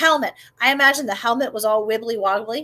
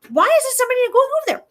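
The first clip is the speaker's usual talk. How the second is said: put-on high, fast, outraged boy; a kid who can't believe it; young David; 12 semitones higher, faster than usual. David to the soldiers, high outraged boy voice. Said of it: "David's just going like".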